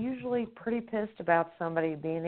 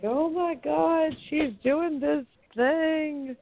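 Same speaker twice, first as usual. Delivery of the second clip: very high, slow astonished squeal; amazed squeal; 10 semitones higher, much slower than usual. Retold or imitated. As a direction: gasp in disbelief. Girl amazed while pinned.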